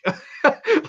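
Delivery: sudden high laugh; high burst of laughter. Real, not acted. Reviewer laughing at his own crude joke, high laugh. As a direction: crack up at your own punchline.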